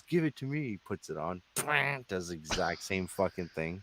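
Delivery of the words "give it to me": higher demanding voice; grabbing the guitar to prove it; lightly voiced skeptic; mock cocky bandmate. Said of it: "He goes".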